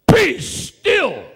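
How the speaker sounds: sudden high, loud command to the storm; commanding shout. Acted. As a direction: order the wind to stop with full authority. Jesus calms the storm.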